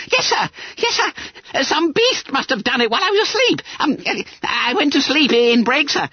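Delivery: high, hurried squeaky voice; flustered schoolboy. A narrator's reading. Bunter explains his nap to Quelch, flustered. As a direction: gabble excuses to the master.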